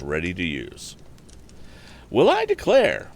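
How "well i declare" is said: high, loud surprised exclamation; a farm wife's astonished cry, much higher; high-pitched, loud astonishment; exclaiming farm wife. A narrator's reading. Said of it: "exclaimed Missus Blackford".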